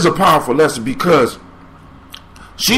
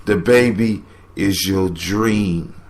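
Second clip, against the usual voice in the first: slowing down; 6 semitones lower, 3 dB quieter than usual. Real, not acted. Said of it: "let me slow down and rewind it".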